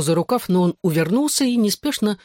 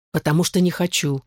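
ordinary delivery

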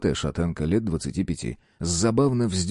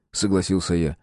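plain reading voice

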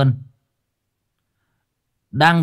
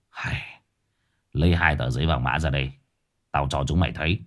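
put-on lower-pitched old man giving orders; gruff-tempered old exorcist; 8 semitones lower, 5 dB quieter, faster than usual. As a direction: voice a low, businesslike Lão Năm. (giọng ông thầy già trầm, sai bảo)

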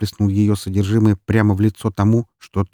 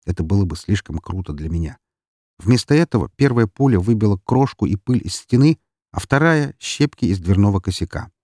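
usual speaking voice

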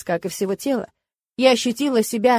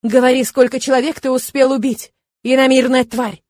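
louder, barked military order; commanding bark; 3 semitones higher, 6 dB louder than usual. Acted. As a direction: bark it at a prisoner like an officer. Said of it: "рявкнул он командирским голосом". (командирский окрик, громче)

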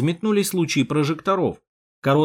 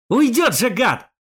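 loud, high angry snarl; angry growl; 9 semitones higher, 4 dB louder, slower than usual. Acted. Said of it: "рыкнул Яшка".

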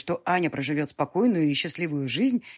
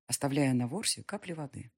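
ordinary delivery, nothing marked

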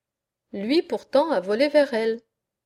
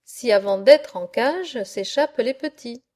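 ordinary delivery

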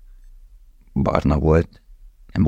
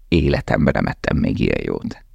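dry irony; 7 semitones lower than usual. Acted. Lale's dry sarcastic reply.

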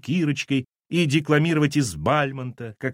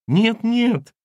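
loud, very high wailing denial; lamenting; 11 semitones higher, 6 dB louder than usual. Acted. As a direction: burst into sudden lament. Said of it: "неожиданно запричитала Валентина".

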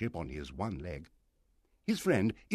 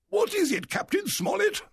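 very high, loud demand; impatient high-pitched squire; blustering squire; 6 dB louder than usual. A narrator's reading. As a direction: demand to know what the matter is. Squire challenges angry captain.

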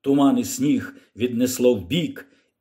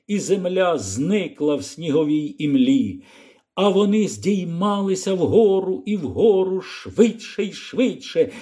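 plain reading voice

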